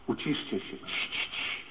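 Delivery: very soft shushing, trailing into whispered sh; hushing. Retold or imitated. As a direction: hush people under your breath. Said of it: "szczególnie to uciszanie". (ciche uciszanie, szeptane „cii”)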